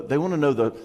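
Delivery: ordinary delivery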